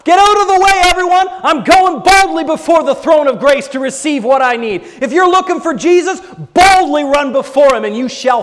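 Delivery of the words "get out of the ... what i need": loud, high, barging forward; bold shout. Retold or imitated. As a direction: push through everyone boldly. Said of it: "we need to do that".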